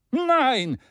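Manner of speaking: high loud Nein; Maston's eager shout; energetic shout. Acted. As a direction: cut in with force. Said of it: "rief Maston energischer als die anderen".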